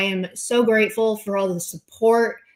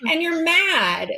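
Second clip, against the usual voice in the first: 1 mocking taunt; 8 semitones higher than usual. Real, not acted. Taunting critics.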